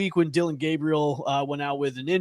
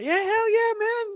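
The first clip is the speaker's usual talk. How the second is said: very high, hyped hell yeah; excited cheer. Real, not acted. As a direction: cheer like a hype man.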